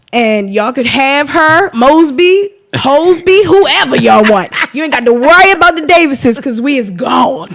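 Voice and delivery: loud, defiant and dismissive, calling the officials out by name. Real, not acted. Wife to Baltimore officials, defiantly loud and dismissive. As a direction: tell them off, they can have the city.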